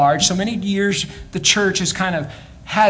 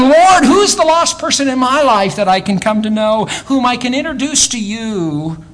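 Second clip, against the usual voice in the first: fervent prayer; 7 semitones higher, 3 dB louder than usual. Acted. Preacher lifts into fervent prayer.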